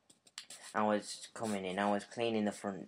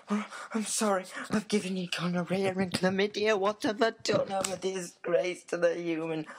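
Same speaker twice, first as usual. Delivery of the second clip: very high, loud, whispered panic; frantic high whisper; 11 semitones higher, 5 dB louder than usual. Pretend. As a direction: gush a panicked confession in a squeaky voice. Pretend voicemail confession.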